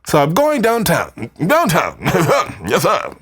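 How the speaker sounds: put-on high, snooty British accent with laughs; posh British. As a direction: act like a rich English lord. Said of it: "Sounds British, right?".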